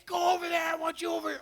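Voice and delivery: high shouts at a dog; mock yelling. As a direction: yell orders like a fed-up owner. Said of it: "yelling and screaming".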